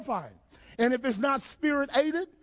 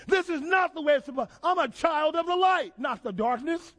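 normal talking voice

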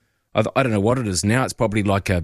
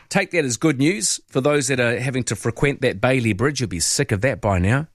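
usual speaking voice

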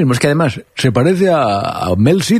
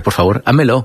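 ordinary talk

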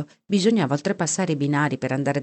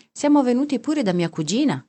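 pitched up as if defending himself; defensive; 8 semitones higher than usual. A narrator's reading. Melo answers the woman who calls them crazy, defensive. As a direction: justify yourself in a higher voice. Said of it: "come per difendersi".

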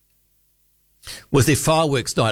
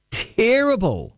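higher, slow, stretched-out scorn; drawn-out disgust; 8 semitones higher, much slower than usual. Real, not acted. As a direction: drag the word out in mock horror. Host scorns Mary Poppins film.

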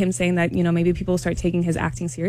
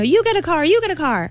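high-pitched giveaway announcement; talk-show host's excited proclamation; Oprah; 9 semitones higher than usual. Retold or imitated. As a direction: hand out prizes in a high, excited call. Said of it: "if you were in the audience during Oprah's show".

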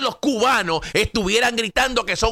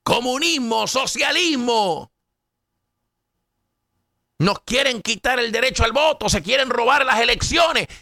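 mock outcry; 5 semitones higher than usual. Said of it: "Eso es lo que estuvieran diciendo".

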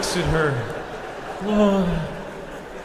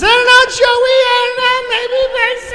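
put-on much higher, hesitant girl voice; mock girly voice. Darlene answering Joey's invite, high mock girly dithering. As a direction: play a dithering young woman, way up high. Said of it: "She says".

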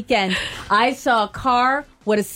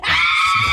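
witch shriek; 6 semitones lower than usual. Acted. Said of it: "my spooky witch voice".

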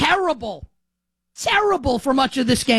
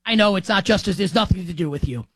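usual speaking voice

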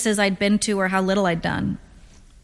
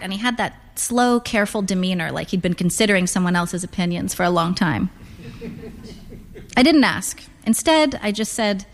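ordinary delivery, nothing marked

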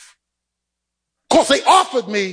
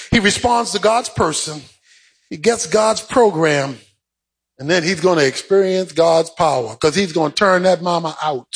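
usual speaking voice

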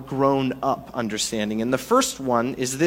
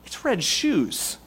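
higher, incredulous pleading; exasperated protest; 6 semitones higher than usual. Pretend. Congregant objecting to the dictator, higher and incredulous. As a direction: protest it like it's absurd. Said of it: "'Cause you're gonna say".

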